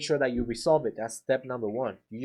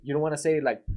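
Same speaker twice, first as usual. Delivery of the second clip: ordinary talk, nothing marked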